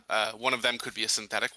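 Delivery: ordinary talk, nothing marked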